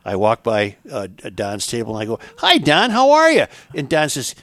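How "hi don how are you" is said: high, loud, hearty hello. Retold or imitated. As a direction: boom a cheery hello up high. [name] greeting in story.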